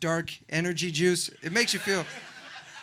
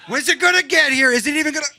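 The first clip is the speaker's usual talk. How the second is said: loud, raised, mock-thrilled voice; excited anticipation; 4 semitones higher, 9 dB louder than usual. Man feeling a yawn coming on, loudly excited. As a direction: get worked up as the yawn approaches. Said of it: "That makes life exciting".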